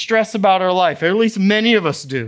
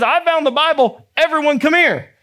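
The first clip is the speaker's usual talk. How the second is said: high, excited calling-out; excited summons; 8 semitones higher than usual. Acted. King Josiah gathering all his people, excited summons. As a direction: summon everyone to share the find.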